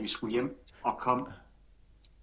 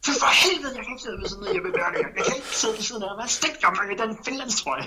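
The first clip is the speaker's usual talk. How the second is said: higher and louder, indignant grumble turning to laughter; mock outrage; 9 semitones higher, 6 dB louder than usual. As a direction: ham up a teammate fuming about his seat. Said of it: "følte sig". (spøgefuld forargelse, højere og grinende)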